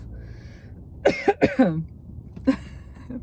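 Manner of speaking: dry real cough. Real, not acted. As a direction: cough into the mic. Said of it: "Perfect example".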